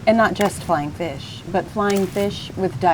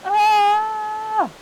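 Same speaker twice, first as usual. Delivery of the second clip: high, sudden scream; startled high scream; high scream in the dark. A narrator's reading. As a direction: yelp ah as the fire dies. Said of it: "said the two friends together".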